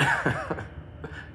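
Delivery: high laugh trailing away; short amused laugh; real chuckle. Real, not acted. Closing an insane weekend story, amused laugh.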